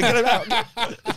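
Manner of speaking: slow, high shout through laughter; laughing shout. Retold or imitated. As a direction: drag out the yell while laughing. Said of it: "I'm going".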